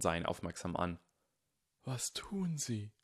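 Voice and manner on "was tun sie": slow hushed whisper, leaning in. A narrator's reading. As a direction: ask it slowly under your breath. Dumbledore leaning in confidentially.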